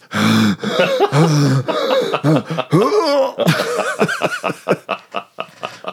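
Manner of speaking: high, exaggerated wheezing gasps; imitated winded gasping. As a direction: act out heaving for air after a hard chase. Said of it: "My lungs are on fire".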